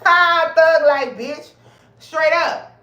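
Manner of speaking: high, loud laughing brag; laughing triumph. Real, not acted.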